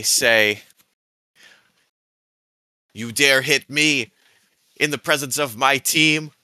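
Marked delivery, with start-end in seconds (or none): 2.9-6.3 haughty overlord, pitched higher; dark lord. Acted. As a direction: command him to kneel like a tyrant. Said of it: "as I do an iron command".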